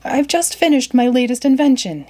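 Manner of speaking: father bursting with pride to his daughter; excited exclaim. A narrator's reading. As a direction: burst out proudly with your news. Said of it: "he exclaimed".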